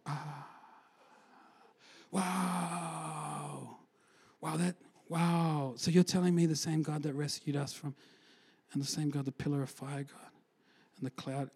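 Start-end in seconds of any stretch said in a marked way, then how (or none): 0.1-5.7 awed hush. Retold or imitated.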